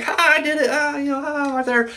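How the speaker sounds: high laughing gloat with a put-on voice. Ospreay gloating to the crowd, laughing high. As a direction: crow with a taunting laugh. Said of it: "gloating, like".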